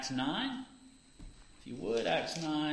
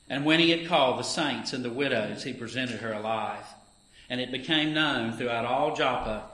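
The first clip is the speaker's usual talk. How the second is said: raised, earnest Bible reading voice; scripture reading; 4 semitones higher, 8 dB louder than usual. Acted. Reader giving the morning lesson to the congregation, earnest scripture reading. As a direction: read the passage out like from the pulpit. Reads Acts nine aloud.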